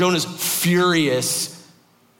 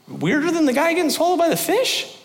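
incredulous listener; 14 semitones higher than usual. Retold or imitated. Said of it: "You're like".

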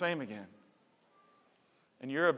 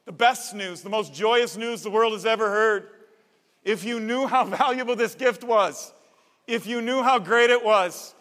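louder and higher, bursting with joy, a laugh breaking in; joyful angel proclamation; 6 semitones higher, 9 dB louder than usual. Acted. Angel announcing joy to shepherds, loud and jubilant. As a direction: proclaim the good news at full force.

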